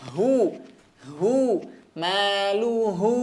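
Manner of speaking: hu held for two beats, then slow maluhu; two-beat vowel. Shown as a demonstration. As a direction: hold the hu in maluhu two counts. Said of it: "dua harokat". (hu dua harokat)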